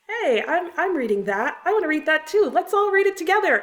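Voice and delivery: mock eager reader shouting "me too". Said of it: "all say".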